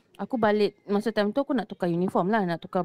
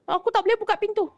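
panicked friend; 11 semitones higher, faster than usual. Retold or imitated. Panicked friend stuck in cubicle.

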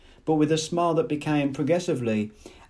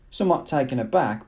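ordinary delivery

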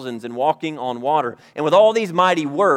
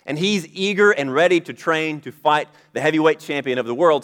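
ordinary talk, nothing marked

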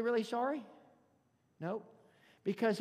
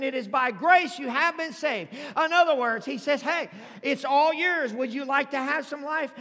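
full-force preaching; 5 semitones higher, 11 dB louder than usual. Acted. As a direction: preach it out to the back row.

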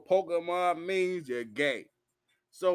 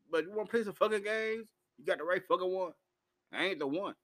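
ordinary talk

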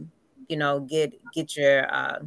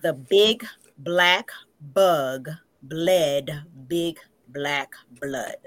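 slow, every consonant crisp; slow articulated demo; 3 semitones lower, much slower than usual. Shown as a demonstration. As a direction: stretch each word out slowly. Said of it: "But the words are".

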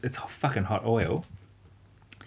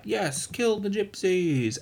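mock mob cheer; 10 semitones higher, much slower than usual. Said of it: "townspeople are like".

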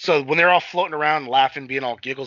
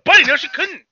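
shouted denial; 9 dB louder than usual. Real, not acted. Heated argument about the character.

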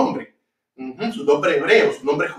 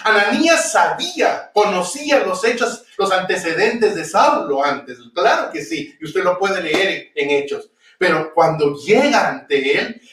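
ordinary talk, nothing marked

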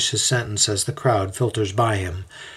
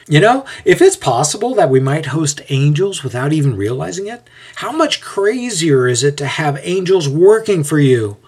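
lightly acted wonder, pitched higher; lively, higher storybook dialogue; amused amazement; 6 semitones higher, 4 dB louder than usual. A narrator's reading. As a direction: marvel aloud at a crazy idea. Jason amazed, after laughing.

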